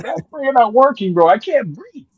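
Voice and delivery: high, pleading panic; begging through breathlessness; panicked. Retold or imitated. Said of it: "I'm like".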